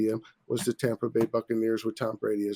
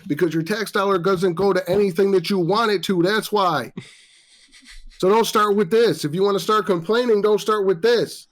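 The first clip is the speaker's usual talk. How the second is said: louder, higher angry scolding; heated rant; 6 semitones higher, 4 dB louder than usual. Real, not acted. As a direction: scold them, fired up. Ranting at complaining fans.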